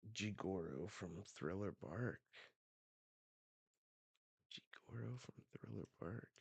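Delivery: very soft, thinking aloud; musing to himself. Real, not acted. Reader puzzles over a character's name, musing quietly. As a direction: say it softly, fading further on the repeat.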